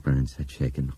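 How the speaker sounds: plain reading voice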